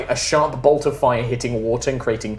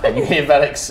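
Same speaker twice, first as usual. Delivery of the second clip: ordinary delivery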